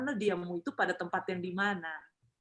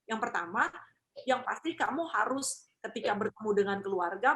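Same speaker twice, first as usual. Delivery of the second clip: usual speaking voice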